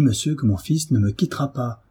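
plain reading voice

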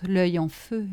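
ordinary delivery, nothing marked

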